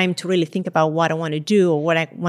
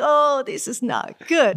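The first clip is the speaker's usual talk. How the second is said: slow, higher mock worry; mock dread; 7 semitones higher, slower than usual. Standing at the start line, thinking about the heat in slow mock dread. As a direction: drag it out like an ominous thought.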